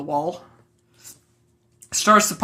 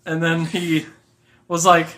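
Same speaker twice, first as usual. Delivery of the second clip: usual speaking voice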